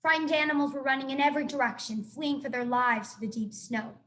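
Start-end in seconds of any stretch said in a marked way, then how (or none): none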